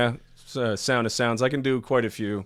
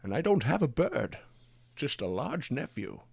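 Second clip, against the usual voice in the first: Sean Connery impression; 3 dB quieter than usual. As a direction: purr it like Connery. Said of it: "I can do Sean Connery pretty well".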